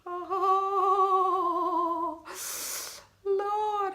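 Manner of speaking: high, loud cry of dismay; shocked exclamation. Real, not acted. Vlogger shocked at her big contoured nose, crying out high. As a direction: gasp it out at the mirror.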